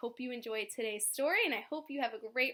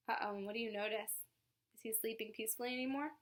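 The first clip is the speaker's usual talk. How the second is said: plain reading voice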